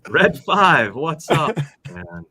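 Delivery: normal talking voice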